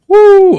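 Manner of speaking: loud, high-pitched "woo"; high whoop. Real, not acted. Streamer after stumbling over the reading, high whoop. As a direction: let out a big shrill whoop.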